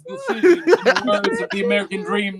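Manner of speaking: southern twang with a lisp; Dusty Rhodes. Retold or imitated. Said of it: "spot-on impersonation of Dusty Rhodes".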